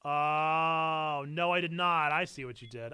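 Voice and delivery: ordinary delivery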